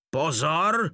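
shrieked command. A narrator's reading. Captain shrieking at his soldier to attention. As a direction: snap it out like a furious officer. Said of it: "zaječel kapitán von Páder".

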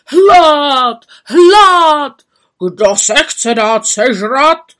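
loud, hungry ogre roar; roaring cannibal demanding food; cannibal shouting. A narrator's reading. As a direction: bellow it like a starving cannibal. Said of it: "lidojed křičel".

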